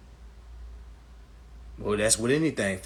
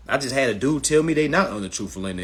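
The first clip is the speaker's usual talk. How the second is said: ordinary talk, nothing marked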